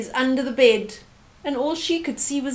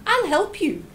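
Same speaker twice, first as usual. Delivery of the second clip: put-on higher eager child voice; girl offering to help mum; little girl; 7 semitones higher than usual. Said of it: "said Sanele".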